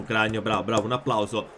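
ordinary talk

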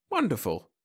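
pleased "Wonderful" with a falling tune; delighted. A narrator's reading. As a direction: savour it with delight. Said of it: "rubbed his hands in delight".

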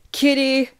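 high, loud summons; scream. A narrator's reading. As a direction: yell for your sister in another room. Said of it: "I scream".

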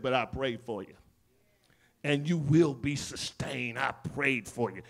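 low, soft, reassuring; Jesus comforting Peter, low and tender. Acted.

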